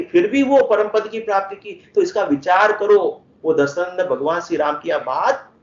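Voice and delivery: ordinary talk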